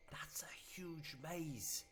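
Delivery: high, half-whispered awe; slow, breath-caught wonder; hushed amazement. Real, not acted. Player seeing the whole maze from above, hushed amazement.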